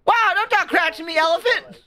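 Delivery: high-pitched, playful indignation; mock outrage. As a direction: act offended in a high squeal. Mock quarrel with game's elephant.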